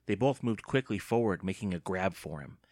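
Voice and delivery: usual speaking voice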